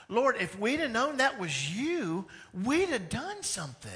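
pleading protest. Retold or imitated. People protesting to the Lord.

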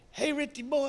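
high-pitched, affectionate phone hello; dad's cheery greeting. Retold or imitated. Dad phoning his son, cheery greeting. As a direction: imitate an elderly dad hailing his son.

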